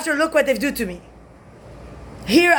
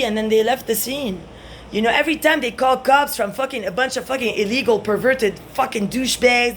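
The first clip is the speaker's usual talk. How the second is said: ordinary talk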